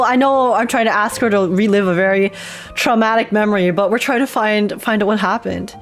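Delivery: normal talking voice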